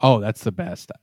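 voice cracking. Real, not acted. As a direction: voice cracks mid-phrase. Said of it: "My voice just cracked".

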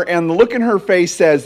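usual speaking voice

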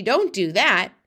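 loud, startled telling-off; yelled protest. A narrator's reading. BFG begs Sophie to stop hurting his ear, yelled protest. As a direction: shout for it to stop. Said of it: "yelled the B F G".

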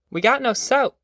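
put-on high curious child voice; child's voice. A narrator's reading. Child answering Ma.

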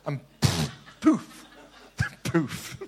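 spoken magic poof noises; poof sound effect. Pretend. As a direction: puff out a mock magic poof. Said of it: "Sound effects with this message tonight".

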